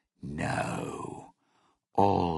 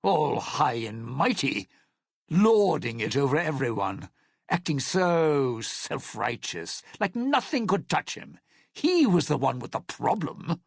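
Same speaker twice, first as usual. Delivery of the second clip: resentful rant; 7 semitones higher, 3 dB louder than usual. Acted. Bitter rant about Sinclair.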